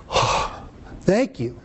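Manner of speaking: breathy, hushed "Oh, thank you"; mild imitated relief, breathed and quiet; relieved thanks. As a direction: let the relief out on a soft sigh. Grateful reply to rescuer.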